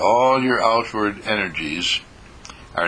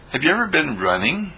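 ordinary talk

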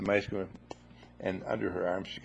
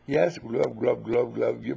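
gurgling glub; 4 dB louder, slower than usual. Retold or imitated. Soaked by water on phone.

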